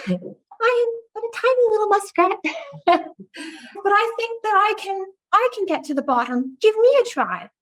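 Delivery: high, small muskrat voice; squeaky little muskrat pleading; tiny muskrat voice. Acted. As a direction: make yourself sound tiny but brave. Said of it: "always do these little voices".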